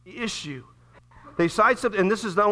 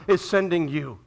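usual speaking voice

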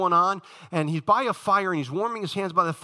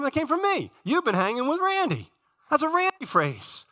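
playful claiming; 10 semitones higher than usual. Real, not acted. Preacher teasing elders who copy him.

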